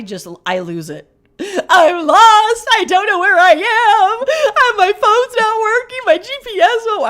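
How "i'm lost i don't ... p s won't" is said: high, loud tearful panic; blurting it out in a crying panic; reliving losing it on the phone, crying and panicked. Retold or imitated. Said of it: "Lamaze comes into action".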